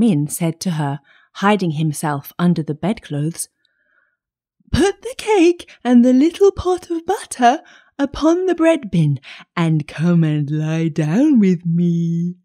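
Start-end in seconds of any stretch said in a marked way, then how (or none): 4.7-12.4 high fake-granny voice, dropping and slowing at the end; wolf posing as grandmother. A narrator's reading. Wolf in bed luring the child, high fake-grandmother voice.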